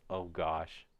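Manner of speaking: ordinary delivery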